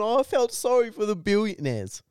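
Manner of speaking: high taunting imitation of a friend; mocking whine.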